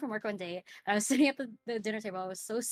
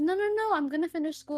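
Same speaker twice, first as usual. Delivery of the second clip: higher, louder no no no; pleading reassurance; 9 semitones higher, 4 dB louder than usual. Retold or imitated. Telling her crying mom she will finish school, pleading. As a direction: rush to calm her down, pleading.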